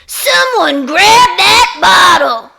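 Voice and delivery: high, loud, slow urgent order; scream. Acted. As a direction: scream the order across the room. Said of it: "Missus Dugan screamed".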